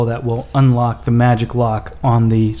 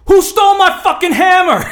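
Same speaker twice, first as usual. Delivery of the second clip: high, loud enraged yell; furious scream; 5 dB louder than usual. Acted. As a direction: scream it down the stairwell. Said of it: "blood-curdling scream".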